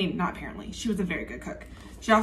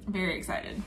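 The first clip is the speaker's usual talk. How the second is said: very excited dropped much softer, almost to herself; quiet repeat; 3 semitones lower, 8 dB quieter than usual. Real, not acted. Repeating her excitement to viewers, suddenly much softer. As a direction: echo it under your breath. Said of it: "I'm very excited".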